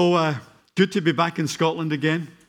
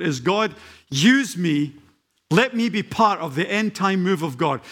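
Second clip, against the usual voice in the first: louder and higher, rising sermon build; preaching at full force; 5 semitones higher, 3 dB louder than usual. Acted. Preacher urging the church they are the generation, preaching at full force. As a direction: fire up the whole congregation.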